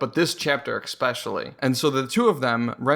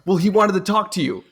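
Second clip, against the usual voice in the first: higher-pitched acted protest; henchman's protest; 5 semitones higher, 4 dB louder than usual.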